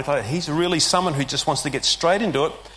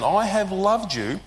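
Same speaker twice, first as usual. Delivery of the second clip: slow, higher, weighty declaration; emphatic statement; 5 semitones higher, slower than usual. Acted. Sovereign Lord declaring love, emphatic and slow. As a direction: press the words home. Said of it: "makes this emphatic statement".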